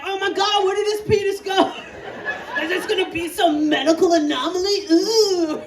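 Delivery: high, fast, thrilled alarm; rushing to the room thrilled; excited panic. Retold or imitated. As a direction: gasp it out like a medical mystery. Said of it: "I'm like".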